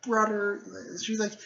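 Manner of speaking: high-pitched sister voice; lightly acted girl, high voice; little sister. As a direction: call to a brother, pitched well up. Girl running to her brother.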